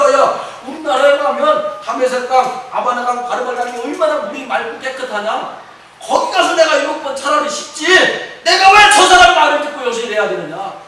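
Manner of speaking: loud, high offended rant, rising to a shout; indignant general. Retold or imitated. Preacher voicing Naaman's outrage at the prophet, loud and high. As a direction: huff and protest like a wounded proud general. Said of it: "그러니까 뭐라고 하는 거야?".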